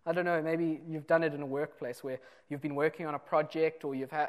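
ordinary talk, nothing marked

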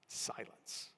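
soft, unvoiced near-whisper; barely voiced, very soft word; hushed. Shown as a demonstration. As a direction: drop to a hush after a long pause. Hushed contrast after the loud climax.

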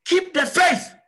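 high, loud preacher's cry; forceful exhortation. Real, not acted. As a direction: shout it out as a charge to the congregation.

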